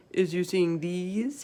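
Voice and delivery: slow, proud giant voice. A narrator's reading. Giant proudly shows Sophie his ears. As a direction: show off the ears slowly. BFG voice in read-aloud story.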